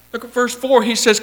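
usual speaking voice

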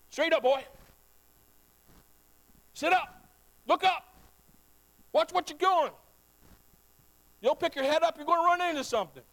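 put-on a parent telling off a slouching boy; parent's playful scold. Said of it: "hear your parents saying that to you".

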